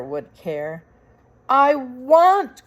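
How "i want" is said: put-on a loud, high childish cry. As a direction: beg from the heart. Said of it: "cried Mig".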